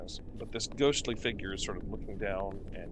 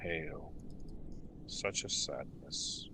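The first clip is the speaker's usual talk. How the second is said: low, slow, half-whispered lament; mournful ghost; 6 semitones lower, 6 dB quieter, slower than usual. Acted. Ghost answers the adventurer in a low mournful whisper. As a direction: sigh it out like a grieving spirit.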